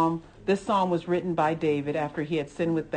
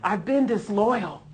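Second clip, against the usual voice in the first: fervent prayer; 2 semitones higher, 5 dB louder than usual. Real, not acted. Preacher praying aloud to God, fervent confession. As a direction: pour out a heartfelt confession to the Lord.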